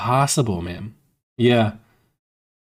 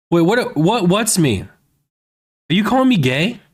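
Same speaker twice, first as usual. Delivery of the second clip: incredulous; 6 semitones higher, slower than usual. Real, not acted.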